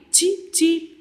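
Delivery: put-on high-pitched baby bird cheeps; sparrow chirps. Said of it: "The sound is".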